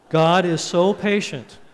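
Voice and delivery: slow and loud, weight on "so patient"; emphatic declaration. Real, not acted. Preacher driving the point home to congregation, slow and emphatic.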